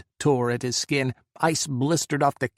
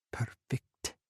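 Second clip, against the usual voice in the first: a hushed whisper, much softer; 11 dB quieter than usual. A narrator's reading. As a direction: breathe the word to yourself. Inner thought, whispered.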